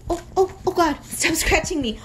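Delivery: high, flustered protest; flustered yelps. Real, not acted. Pushing off the scratching dog, high and flustered.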